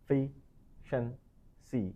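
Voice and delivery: efficiency drawn out very slowly, stress on the second syllable. Shown as a demonstration. Teacher slowing efficiency so learners can copy it. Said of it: "stress দিতে হবে second সিলেবলের উপরে".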